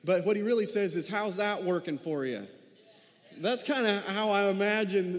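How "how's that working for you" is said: lightly voiced southern talk-show host; Doctor Phil. Pastor lightly imitating Doctor Phil asking Jonah, southern twang. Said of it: "Doctor Phil".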